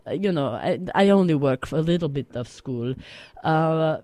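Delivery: put-on broken English with a heavy Russian accent, reminiscing; Russian-accented grandma character spinning a tale on the radio. Plays Russian grandma Olma telling story.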